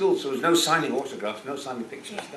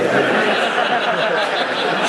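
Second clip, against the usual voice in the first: loud high laugh breaking out; burst of laughter; 8 semitones higher, 7 dB louder than usual. Real, not acted. Laughing at the missed chance with the co-star. Joking she'd be here now.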